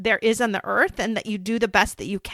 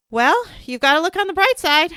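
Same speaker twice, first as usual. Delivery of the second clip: mock cheery; 7 semitones higher, 6 dB louder than usual. Mother mimics tactless phlebotomist.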